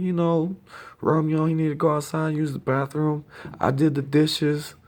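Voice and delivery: high, light buddy voice; mock innocent friend explaining; imitating friend. Voicing friend's casual answer.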